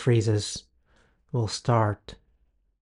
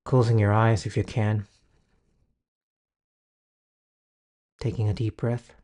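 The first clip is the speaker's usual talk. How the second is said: guided meditation. Acted. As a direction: ease the listener into calm.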